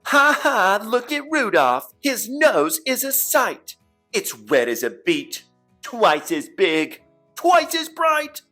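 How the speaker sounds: loud, high-pitched mocking chant; jeering taunt. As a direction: shout it out as playground teasing. Reindeer mock Rudolph's nose.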